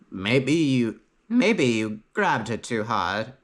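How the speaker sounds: lightly voiced baker's wife, slow and pointed; a hint of a suspicious village wife, drawn out; accusing baker's wife. Baker's wife accusing the boy before the crowd, slow and pointed.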